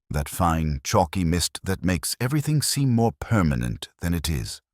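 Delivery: ordinary delivery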